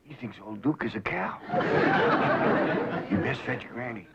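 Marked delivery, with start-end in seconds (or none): 1.4-3.0 high whooping laugh. Acted. Hillbilly guffaws.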